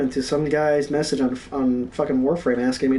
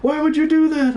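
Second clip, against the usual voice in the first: high, drawn-out mock screams; hammed-up high-pitched screaming; imitated screaming; 13 semitones higher, 3 dB louder, slower than usual. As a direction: wail it like panicking teammates, very high pitch. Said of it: "I hear them screaming".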